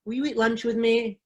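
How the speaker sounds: ordinary talk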